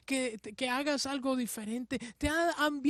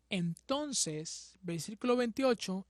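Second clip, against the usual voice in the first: ordinary talk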